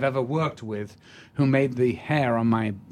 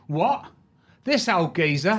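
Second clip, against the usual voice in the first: cockney mock surprise; 5 semitones higher than usual. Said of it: "he went".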